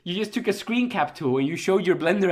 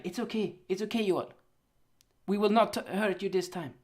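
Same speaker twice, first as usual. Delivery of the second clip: soft, hushed fake French accent, coaxing; a playful French accent, soothing and sly; mock French accent; 6 dB quieter than usual. Studio Mappa coaxing Joel in a mock French accent. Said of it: "Why am I speaking so much French accent today?".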